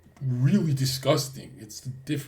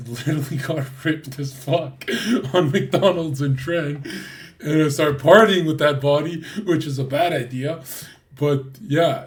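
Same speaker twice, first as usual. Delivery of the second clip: louder, boasting about getting ripped; loud excited boasting; 2 semitones higher, 8 dB louder than usual. Real, not acted. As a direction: brag it out loud.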